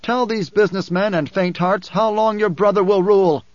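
loud, high, ringing challenge; calling out. A narrator's reading. Man asks Alia about her brother's rule, calling out. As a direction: shout the challenge, building to the end. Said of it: "a man directly in front of Paul called".

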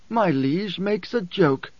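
high, slow, trembling protest. A narrator's reading. Korba nervously answers his emperor, voice trembling. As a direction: draw it out nervously, pitched high. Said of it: "voice trembling".

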